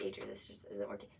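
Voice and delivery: a deep, hushed parody of being serious; deep mock serious. Shown as a demonstration. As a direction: drop the voice low and play it grave. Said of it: "I'm trying to be serious".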